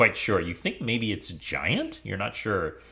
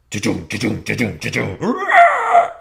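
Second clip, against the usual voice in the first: galloping thuds bursting into a loud roar; imitated boar charge; 9 dB louder than usual. Game master acts out the boar's charge, imitated gallop and roar.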